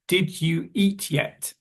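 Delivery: "Did you eat yet" slowly, word by word; each word of "Did you eat yet" separate; careful full form. Shown as a demonstration. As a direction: sound out "Did you" fully. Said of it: "someone may ask you".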